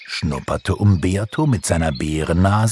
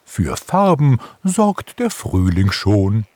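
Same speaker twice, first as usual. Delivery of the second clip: higher character voice of an owl; old owl; 5 semitones higher, 4 dB louder than usual. A narrator's reading. Old owl explains spring brings colours, in owl voice. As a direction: reassure them as an old owl. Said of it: "lachte die alte Eule".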